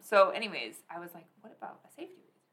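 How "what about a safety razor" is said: soft, quick, whispery idea; hushed mock revelation. Recalling her idea for a razor solution, hushed and quick. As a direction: whisper it like a sudden brainwave.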